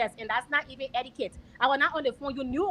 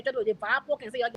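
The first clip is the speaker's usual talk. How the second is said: normal talking voice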